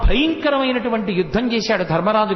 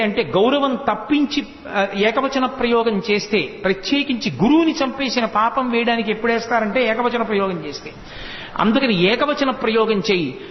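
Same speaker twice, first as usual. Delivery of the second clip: ordinary talk, nothing marked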